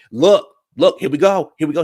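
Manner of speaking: loud, high, fired-up call; mock loud warning. The fighter squaring up, a loud mock shout. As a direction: shout it like he's ready to fight. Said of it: "He said".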